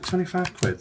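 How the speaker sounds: high, rising question on twenty-five quid; a mild mock double take at twenty-five quid; surprised echo. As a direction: pitch it way up, taken aback by the price. Jay echoes the price back.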